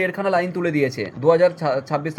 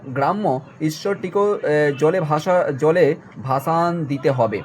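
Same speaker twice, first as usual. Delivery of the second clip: slow dictation; much slower than usual. Shown as a demonstration. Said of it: "reading the text slowly".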